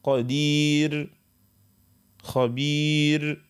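قدير and خبير drawn out very slowly, light R; thin R at pause. Shown as a demonstration. Teacher models the light R in قدير and خبير. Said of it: "كيما".

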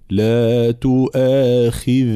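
تؤاخذني slow with flowing voiced ذ; voiced soft ذ. Shown as a demonstration. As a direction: let the ذ in تؤاخذني flow. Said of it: "مجهور ورخو". (الذال مجهورة رخوة)

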